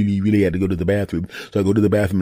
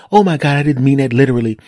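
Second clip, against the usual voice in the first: higher, louder mock wife; imitated appalled wife; 6 semitones higher, 5 dB louder than usual. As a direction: exclaim as the exasperated wife.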